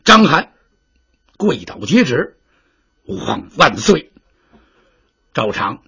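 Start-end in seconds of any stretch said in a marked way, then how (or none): 3.0-4.1 low, formal hail to the emperor; a subject bowing to the throne, voice dropped; kneeling general. Acted. General kneeling before the envoy, low formal hail.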